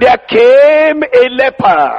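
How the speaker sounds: "came" stretched out with emphasis; stress on came. Shown as a demonstration. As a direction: lean slowly on the word "came". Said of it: "Why am I emphasizing what he came?".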